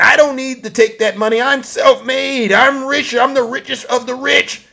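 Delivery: high, bragging mock quote; Donald Trump. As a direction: mimic a boaster, pitched well up. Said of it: "He's bragging".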